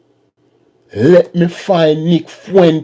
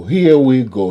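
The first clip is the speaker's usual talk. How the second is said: ordinary talk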